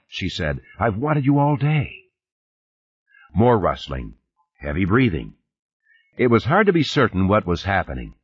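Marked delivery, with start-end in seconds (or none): 0.8-2.0 higher-pitched, mildly acted desire; a woman's urgent longing, voiced high; passionate longing. A narrator's reading. Woman on the tape to her lover, passionate longing. Taped seduction, acted by narrator.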